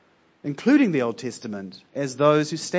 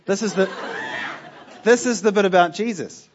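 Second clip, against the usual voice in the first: laughing; 5 semitones higher, 4 dB louder than usual. Real, not acted. Laughs introducing the Jesus part.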